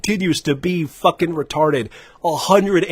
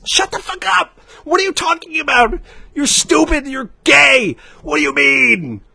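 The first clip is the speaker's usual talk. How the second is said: high, screamed angry outburst; mad yelling; 10 semitones higher than usual. Real, not acted. Ranter shouting down someone loyal to one store, mad yelling. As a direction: cut them off with a furious high yell. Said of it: "this shit makes me so mad".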